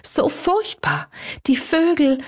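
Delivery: high, slow tearful cry; frightened lady sobbing to her butler about the birds. A narrator's reading. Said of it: "schluchzte sie".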